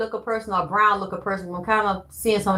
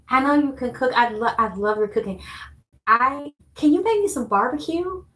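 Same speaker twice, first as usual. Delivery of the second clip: a lightly voiced eager woman, pitched higher; sweet, higher asking voice, like a client begging a favour; mock eager client; 4 semitones higher than usual. Voicing a client asking for barbecue.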